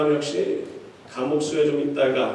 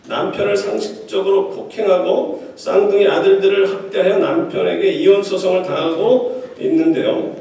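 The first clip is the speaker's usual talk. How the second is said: normal talking voice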